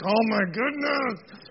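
slow, drawn-out exasperation; mock dismay; exasperated sigh. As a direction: groan it out, fed up with the noise. Dad annoyed by chewing noise.

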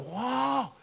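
breathless, rising excitement; excited crowd. Retold or imitated. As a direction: gasp then gush like a thrilled audience member. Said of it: "everybody in the crowd just went".

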